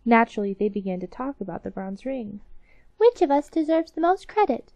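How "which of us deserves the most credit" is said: mock cry. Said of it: "they cried all at once".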